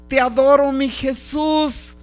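ordinary delivery

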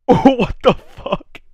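high, slow, drawn-out what the fuck; stunned disbelief. Real, not acted. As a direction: stretch it out in shock. Shocked reaction to overdose story.

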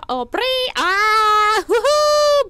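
high, loud whooping; excited cheering. Real, not acted. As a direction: cheer with glee.

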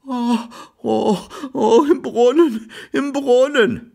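very high, drawn-out wail; loud high-pitched moaning cry. A narrator's reading. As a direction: howl the words out in distress. Wailing servant answers in distress.

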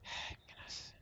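unvoiced anxious whisper. Real, not acted. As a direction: breathe it out under your breath. Anxious aside.

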